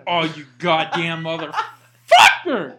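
slow, loud, laughing curse; real disgusted, amused swearing; disgusted outburst. Real, not acted. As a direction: drag out the curse and hit it hard. Reacting to a fart, drawn out.

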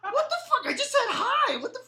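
high-pitched squeaky disbelief; mock bewildered falsetto; baffled protest. Retelling his baffled reply to crying.

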